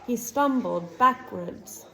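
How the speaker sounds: ordinary delivery, nothing marked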